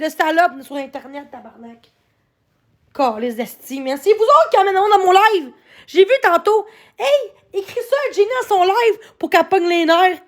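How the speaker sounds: normal talking voice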